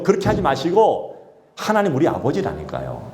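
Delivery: ordinary talk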